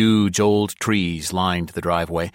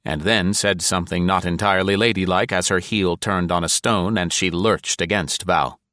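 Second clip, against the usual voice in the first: usual speaking voice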